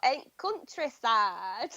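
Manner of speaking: drawn-out, hammed-up country accent; terrible country accent. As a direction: do a bad countryside accent, slowly, for a laugh. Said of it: "that was a really terrible accent".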